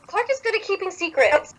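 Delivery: high, sly teasing line. Acted. Narrator voicing a teasing line to Belle. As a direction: tease her knowingly.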